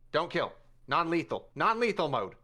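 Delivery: command. Acted. Inventor commands his robot.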